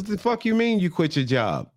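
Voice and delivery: high-pitched mock outrage; pissed-off mother. Mother hearing her daughter quit her job, pissed off. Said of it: "now Sherry is real pissed".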